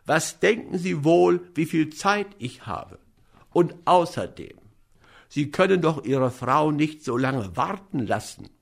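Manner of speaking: ordinary delivery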